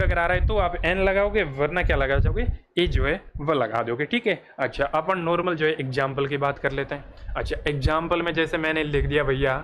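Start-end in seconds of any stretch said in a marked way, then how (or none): none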